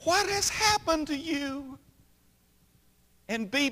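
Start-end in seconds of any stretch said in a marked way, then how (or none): 0.0-1.8 much higher, a mild retold shriek; a hint of a panicked mother, pitched up; mock scream. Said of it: "Missus Bentley screamed and said".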